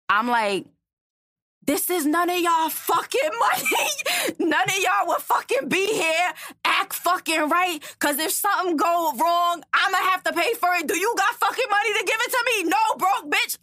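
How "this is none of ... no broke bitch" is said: retold high-pitched furious rant; flipping out. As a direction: go off on the whole room, pitch way up. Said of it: "I flip the fuck out".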